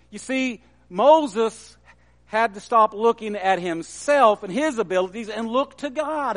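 ordinary delivery

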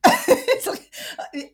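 slow, high laugh-cry; tearful laugh. Real, not acted. Laughing crying repeat.

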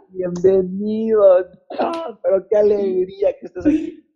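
very high, slow, delighted greeting; high, drawn-out joyful welcome; gushing welcome. Retold or imitated. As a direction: welcome him like an overjoyed fan. Retold greeting at the airport.

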